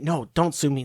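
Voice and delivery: ordinary talk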